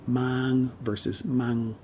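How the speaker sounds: "mang" with a long drawn ng, slow; elongated ng. Shown as a demonstration. Teacher contrasting Vietnamese vowels, slow drawn ng. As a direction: stretch the ng in "mang", short a. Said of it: "the N G is elongated as opposed to the A".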